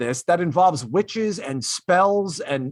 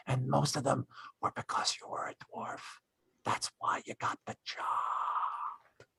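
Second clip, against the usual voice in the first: whispered aside, much softer; 3 semitones lower, 13 dB quieter than usual. Real, not acted. Host whispers a sly dig at the actor, hushed.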